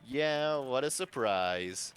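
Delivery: a sarcastic yeah, then slow and flat-toned; deadpan sarcasm, hiding disappointment; host, unimpressed, drawls the words slowly; dry. Acted. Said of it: "Chris remarks dryly".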